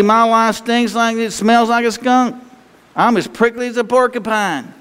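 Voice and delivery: voiced high, a pretend complaint in a whine; high, mock whiny churchgoer. Preacher voicing a congregant's complaint, high and whiny. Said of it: "You say".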